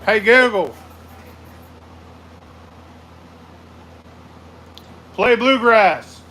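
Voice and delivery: over-enunciate for the device; commanding the speaker. Real, not acted. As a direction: spell it out loud for Google.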